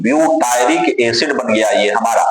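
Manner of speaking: ordinary talk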